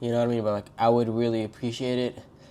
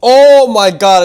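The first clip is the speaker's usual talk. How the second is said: shocked shout; 9 semitones higher, 16 dB louder, much slower than usual. Real, not acted. Smelling the spicy food.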